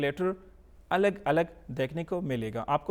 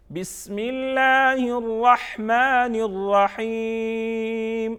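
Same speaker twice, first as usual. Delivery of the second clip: slow, higher chant in one breath-like flow; joined-up recitation; 4 semitones higher, 4 dB louder, much slower than usual. Shown as a demonstration. Teacher models whole Bismillah for pupils, flowing chant. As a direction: recite it connected, stretching long vowels.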